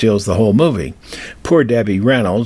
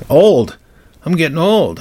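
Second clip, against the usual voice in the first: mock dismay; 8 semitones higher, 4 dB louder than usual. Real, not acted. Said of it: "oh, my God".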